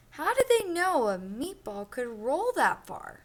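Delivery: lively storybook read-aloud for a class; reading to children. Acted. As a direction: read it like a picture book to kids. Teacher reads picture book aloud.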